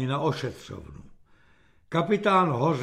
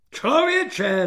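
high, raised exclamation of outrage; thundering; 8 semitones higher, 4 dB louder than usual. A narrator's reading. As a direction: thunder at a clueless subordinate. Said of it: "zařměl". (zahřmět pobouřeně)

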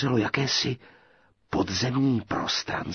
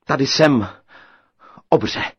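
called out; 5 semitones higher, 10 dB louder than usual. A narrator's reading.

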